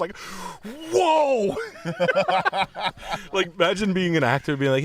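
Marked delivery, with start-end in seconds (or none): 0.9-1.5 high, loud startled whoa; mock yelp. Acting out a startled victim, loud high yelp. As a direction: yelp as if kicked out of nowhere.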